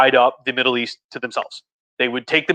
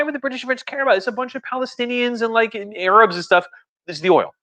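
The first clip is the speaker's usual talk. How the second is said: sarcastic dismissal; 8 semitones higher than usual. Retold or imitated. Sarcastically voicing British indifference.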